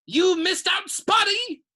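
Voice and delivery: high, loud jeer, not whispered; taunting. A narrator's reading. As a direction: jab at her as a gleeful poltergeist. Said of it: "Peeves hissed in her ear".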